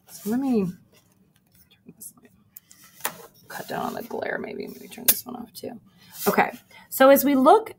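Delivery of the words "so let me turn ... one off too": muttered aside. Real, not acted. Aside while turning lights off.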